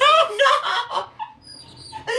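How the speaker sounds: high wail breaking into laughter; laughing dismay. Real, not acted. Streamer told of friends in fanfics, laughing in dismay. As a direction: cry out in amused horror.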